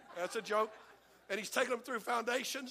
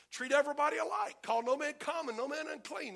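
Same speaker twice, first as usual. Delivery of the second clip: raised, commanding voice of God; God's command; 6 semitones higher, 4 dB louder than usual. Retold or imitated. Preacher voicing God's command to treat everyone alike, raised and commanding. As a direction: announce it like a decree from above. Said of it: "God's told me".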